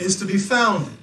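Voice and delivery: loud lead line, laughing a little; loud call with a laugh. Shown as a demonstration. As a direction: call it out, chuckling. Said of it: "repeat after me".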